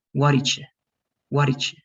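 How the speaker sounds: "What did you" squeezed into "waddidja"; contracted past. Shown as a demonstration. Teacher drilling the past question, contracted. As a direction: run "What did you" together, "did you" as "didja". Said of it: "That D is telling you".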